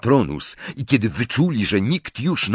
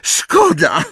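high, raised voice; louder remark; 9 semitones higher, 5 dB louder than usual. A narrator's reading. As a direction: say it louder, pitched well up. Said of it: "dodała głośniej".

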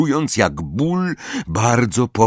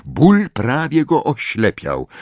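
usual speaking voice

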